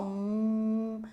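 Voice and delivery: soft hummed hum to feel the vocal cords; a low hum for the class; voiced hum demo. Shown as a demonstration. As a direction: hum so the throat buzzes. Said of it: "você pode perceber que vibra".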